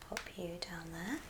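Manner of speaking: usual speaking voice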